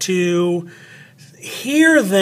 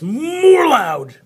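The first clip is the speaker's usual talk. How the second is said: "more" swelled loud and high, stretched out; stress on MORE; 8 semitones higher, 4 dB louder than usual. Shown as a demonstration. Teacher showing what louder means, "more" swelled and stretched. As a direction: lean hard and long on "more" in "more loud". Demonstrates louder part of word.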